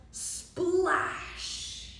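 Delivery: long, drawn-out sound word; slow dramatic splash; splash effect. A narrator's reading. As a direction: stretch it out like the water spreading. Acts out the splash.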